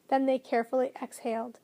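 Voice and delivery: ordinary delivery, nothing marked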